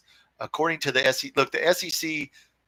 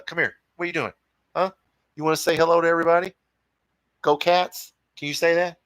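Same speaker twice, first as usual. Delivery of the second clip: talking to the cat; 5 semitones higher, 4 dB louder, faster than usual. Real, not acted.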